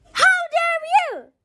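very high, indignant storybook screech; outraged scream. A narrator's reading. Leather Queen screaming at Chip for refusing, outraged screech. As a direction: screech it in outrage, pitched right up. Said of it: "screamed the Leather Queen".

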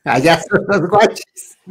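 calling out loud and high; aunt's high calling shout. Retold or imitated. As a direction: yell across to the river. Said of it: "Y grita".

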